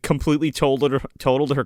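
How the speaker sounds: usual speaking voice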